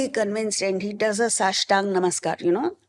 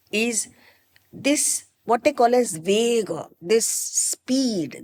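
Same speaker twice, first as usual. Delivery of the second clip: normal talking voice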